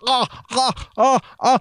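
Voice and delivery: high strained ahs of a patient in the chair; mock pained groans. Patient under the pliers, high mock pained groaning. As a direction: groan up high as the tooth is pulled. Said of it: "And I'm like".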